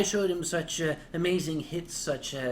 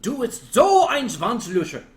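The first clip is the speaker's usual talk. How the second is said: loud, emphatic German line, higher; loud German phrase; 5 semitones higher, 10 dB louder than usual. Pretend. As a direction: belt out the German phrase with mock formality.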